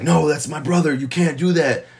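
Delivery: Henry's brother begging him not to hurt Henry; upset brother protesting. Retold or imitated. A touch of a panicked younger brother.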